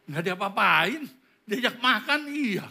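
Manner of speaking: high, loud mock insistence; excited reply. Child telling a friend about dinner, excited insistence.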